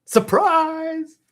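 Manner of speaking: high, loud cry of Surprise!; excited surprise. Shown as a demonstration. Teacher shouting the word as a joke. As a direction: burst out like springing a surprise. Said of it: "surprise word".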